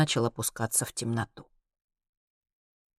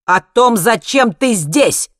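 loud, raised demanding shout; 7 semitones higher, 10 dB louder, much slower than usual. A narrator's reading. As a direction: lose your calm and shout it, stretching the end. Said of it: "закричал Хайман". (крик, потеряв спокойствие)

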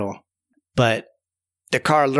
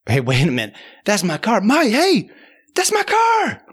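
calling out; 9 semitones higher than usual. Retold or imitated. Owner yelling after the mice stealing his car. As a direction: holler as it gets away.

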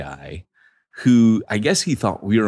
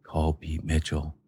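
nagging inner voice; 4 semitones lower, 6 dB quieter than usual. Retold or imitated. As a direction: intone it like a conscience whispering a reminder. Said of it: "I heard a voice saying".

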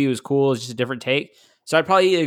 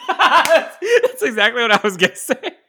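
high, loud laughing agreement; real cracking-up, words stretched by laughter; 12 semitones higher, 5 dB louder, slower than usual. Real, not acted. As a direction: say it through a burst of laughter. Cracking up at co-host's joke.

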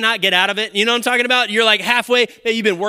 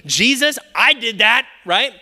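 mock boastful Jesus; 6 semitones higher, 3 dB louder than usual. Continuing the boastful Jesus bit.

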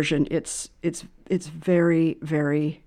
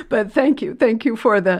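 laughing; 4 semitones higher, 4 dB louder than usual. Real, not acted. Laughing after being moved.

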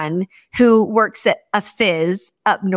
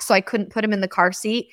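ordinary talk, nothing marked

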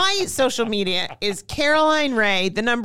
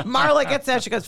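ordinary talk, nothing marked

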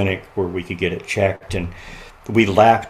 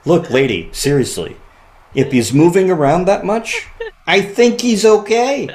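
louder, rising higher, amused and incredulous; laughing off the nurse's worry, climbing up; amused reassurance; 10 semitones higher, 6 dB louder than usual. Retold or imitated.

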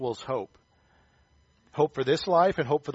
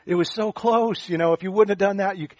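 high, breathy lament with a gasp; mock dismay; 6 semitones higher, 4 dB louder than usual. Onlookers sighing that Paul almost went free. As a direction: play the crowd sighing over a near miss.

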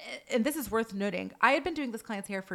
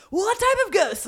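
high, loud, frantic question; mock panic; 11 semitones higher, 9 dB louder than usual. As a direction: imitate an assistant totally spazzing out. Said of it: "So panicked".

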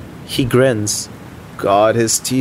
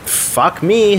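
exasperated; 8 semitones higher than usual. Acted. Frustrated about friend's wedding.

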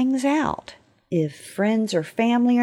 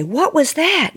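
sudden alarmed call, higher and louder; startled; 7 semitones higher, 6 dB louder than usual. Retold or imitated. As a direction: call out at a crash from the next room. Startled quote about loud noise.

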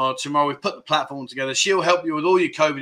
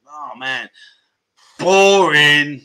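mock boredom; 6 dB louder, much slower than usual. Real, not acted. Dismissing Coronation Street.

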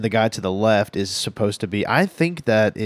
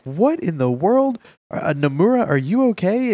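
startled concern; 9 semitones higher than usual. Retold or imitated. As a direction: sound truly startled he's crying. Pop startled by his sobbing.

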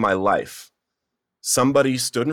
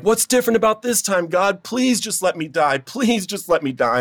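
high-pitched, desperate pleading, re-enacted; screaming at God; 10 semitones higher than usual. Man re-enacting his breakdown in the car, screaming at God. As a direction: cry out in an acted plea, pitched way up. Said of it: "I'm screaming at God".

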